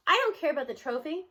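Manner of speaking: plain reading voice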